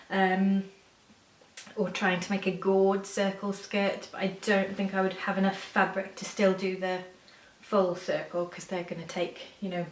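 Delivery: ordinary talk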